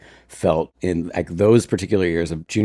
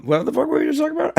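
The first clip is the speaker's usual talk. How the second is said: high, fast, laughing disbelief; giddy outburst; much faster than usual. Real, not acted. Burrito brain, laughing.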